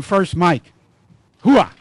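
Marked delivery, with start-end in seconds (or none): 1.4-1.7 sharp rising Army battle cry; loud gung-ho soldier. Acted. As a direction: bark it like a proud soldier. Army veteran greets fellow Army vet.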